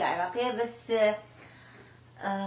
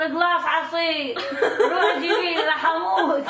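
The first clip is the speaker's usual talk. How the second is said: laughing; 4 semitones higher, 4 dB louder than usual. Retold or imitated. Mother retells her dizzy plea.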